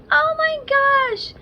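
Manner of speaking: high-pitched mock dismay; amused disbelief. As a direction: exclaim it way up high, half laughing at yourself. Retelling her reaction to own slip.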